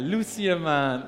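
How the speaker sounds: slow, broken by laughter; laughing. Real, not acted. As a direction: say it through a laugh. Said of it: "laughing while saying it".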